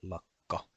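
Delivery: lakka with a held k closure; lakka, pitkä k; long Finnish k. Shown as a demonstration. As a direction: pause on the kk in lakka. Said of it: "a long K sound as well".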